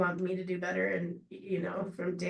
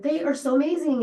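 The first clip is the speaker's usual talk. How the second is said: excited gush; 7 semitones higher, 7 dB louder than usual. Retold or imitated. Raving about Sonic slushes.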